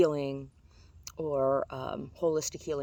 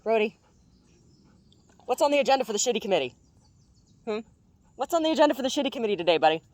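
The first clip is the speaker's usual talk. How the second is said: talking to the dog; 8 semitones higher, 6 dB louder, faster than usual. Real, not acted. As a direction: coax the pup playfully, high and quick.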